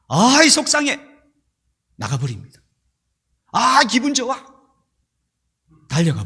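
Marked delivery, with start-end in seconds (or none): 0.1-1.0 high, louder burst of upset; 속상함. Acted. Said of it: "올라오는 감정대로 움직여버립니다". 3.5-4.4 high-pitched acted burst of delight; 신나는 기분. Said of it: "올라오는 감정대로 움직여버립니다".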